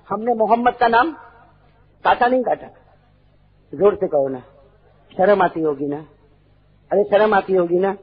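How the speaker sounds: normal talking voice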